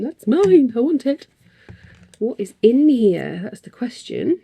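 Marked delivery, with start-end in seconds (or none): 0.0-1.3 loud, high grab for the fabric; snatch it up like a thrilled collector; eager claim. Retold or imitated. Crafter reliving grabbing limited fabric, eager loud claim.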